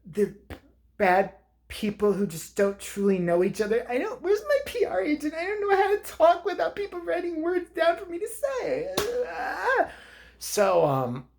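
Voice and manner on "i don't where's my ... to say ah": high whiny, near-tearful mock celebrity voice. Comic mocking a celebrity lost without their PR agent. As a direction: whimper like a helpless star.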